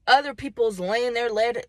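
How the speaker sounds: ordinary talk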